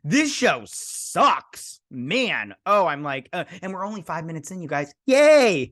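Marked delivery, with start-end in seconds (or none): 0.0-1.8 slow, disgusted groan of a verdict; drawn-out complaint. Real, not acted. Viewer giving up on the show, slow disgust. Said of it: "reacting to the show". 5.1-5.7 high, loud mock cheer; sarcastic yay. Real, not acted. Vlogger mocking the show's pace, sarcastic cheer. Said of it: "sarcastic cheer".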